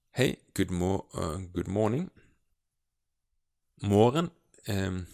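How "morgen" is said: Morgen said with a silent G, like "moren". Shown as a demonstration. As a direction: stress Morgen with the G dropped, "MO-ren". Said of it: "The G is silent".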